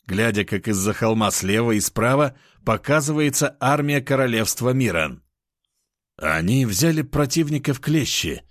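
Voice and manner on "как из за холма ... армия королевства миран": plain reading voice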